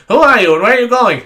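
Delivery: loud, high reporter questions; shouting reporter. Retold or imitated. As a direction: fire questions at a suspect. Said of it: "these journalists come up".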